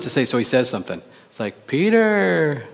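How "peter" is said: long, high call of the name, sliding down; exasperated reproach. Acted. God correcting Peter from the cloud, exasperated. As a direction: chide by drawing out the name.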